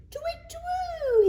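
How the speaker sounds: very high owl hoot; high steady twit twoo; owl call. Acted. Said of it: "he calls".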